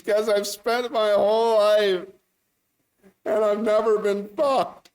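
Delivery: loud, high, drawn-out comic wail; exaggerated sobby lament, stretched slow; mock crying. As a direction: wail it like a hammed-up weeping woman. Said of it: "she starts crying again".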